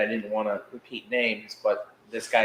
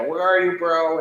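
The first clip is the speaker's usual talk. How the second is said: high, louder calling out; eager call out; 6 semitones higher, 5 dB louder than usual. Retold or imitated. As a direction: shout it out, hunting for a friend. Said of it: "we were like".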